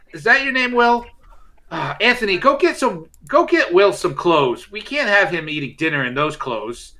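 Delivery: high, loud fussing mother; mother shouting. Acted. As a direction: call out like a flustered mom. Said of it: "your mother immediately shouts at you".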